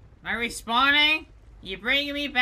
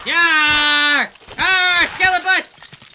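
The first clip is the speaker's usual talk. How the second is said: loud yelling battle cry; battle shout; 3 semitones higher, 7 dB louder, much slower than usual. Real, not acted. Player charging skeletons with a shout. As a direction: roar it as you charge.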